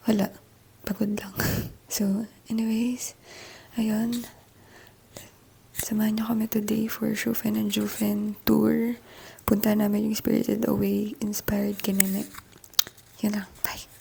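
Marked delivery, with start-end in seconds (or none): 0.9-12.3 soft unvoiced whisper. Real, not acted. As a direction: whisper so no one wakes. Early morning hotel room whisper.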